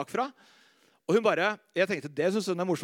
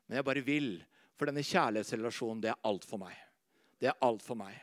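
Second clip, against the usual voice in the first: much softer and lower, sincere; intimate devotion; 8 semitones lower, 6 dB quieter than usual. Acted. Preacher sharing his love for Jesus, soft and sincere. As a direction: confess it gently.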